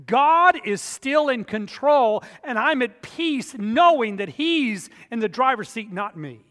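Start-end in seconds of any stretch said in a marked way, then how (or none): 0.1-5.2 fervent proclamation. Real, not acted. Climax of assurance.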